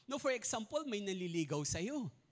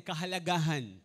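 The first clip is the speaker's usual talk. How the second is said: kahalagahan called out louder; 4 semitones lower, 7 dB louder than usual. Shown as a demonstration. Preacher urging the congregation to repeat kahalagahan louder. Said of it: "Mas malakas sigaw ho natin".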